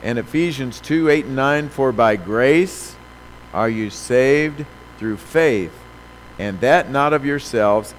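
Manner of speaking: slow, loud, weighty scripture read; preaching. Acted. Preacher reading Ephesians to the church, slow and loud. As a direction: lean hard on every phrase. Reads Ephesians slowly, forcefully.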